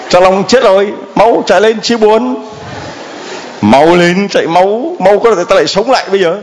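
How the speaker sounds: high, hurried mock alarm; mock urgent call. Priest urging children to rush over, mock alarm. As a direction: pretend panic, hurry the kids along.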